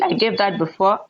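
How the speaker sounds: normal talking voice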